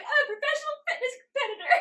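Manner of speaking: high, fast, loud and laughing; laughing protest. Retold or imitated. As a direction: blurt it out through laughter, pitched high. Laughing at her own protest.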